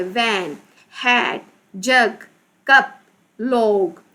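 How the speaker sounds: वैन, हैट, जग, कप, लॉग each in one slow, separate beat; one-syllable words. Shown as a demonstration. Teacher demonstrating one-syllable words, वैन to लॉग each as one separate beat. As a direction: give वैन, हैट, जग, कप, लॉग one sound apiece, with pauses. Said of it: "one time ही pronounce हो रहा है".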